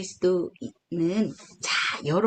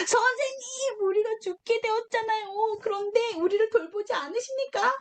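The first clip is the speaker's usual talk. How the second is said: much higher, pleading cry; panicked disciples; 11 semitones higher than usual. Retold or imitated. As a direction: wake the master in a storm, desperate. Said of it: "이렇게 예수님을 깨우는 거죠".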